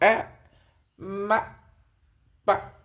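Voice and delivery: ए, म, प each as one short isolated sound; baby monosyllables. Retold or imitated. Teacher showing baby's first sounds, ए, म, प as lone syllables. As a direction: say ए, म, प singly, long pauses between. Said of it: "monosyllable sound बोलना शुरू करता है".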